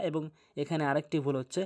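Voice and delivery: normal talking voice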